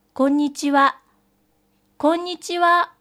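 put-on a child's repeated loud hello; calling loudly. A narrator's reading. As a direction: call out to a child who won't answer. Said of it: "大きな声で続けざまに".

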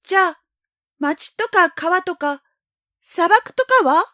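put-on high, slow pleading boy; young boy voice. A narrator's reading. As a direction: drag it out like a child. Prince asks again.

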